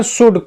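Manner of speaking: शुड with a short oo vowel; correct short "oo". Shown as a demonstration. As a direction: repeat शुड with a plain u, no diphthong. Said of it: "शुड पढ़ रहे हैं". (शुड में छोटे उ का उच्चारण)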